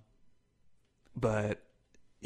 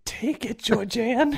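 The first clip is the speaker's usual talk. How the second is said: higher, laughing mock clown taunt; 10 semitones higher, slower than usual. Joking about the clown and his wife, laughing mock clown. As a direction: ham up the clown, cracking up.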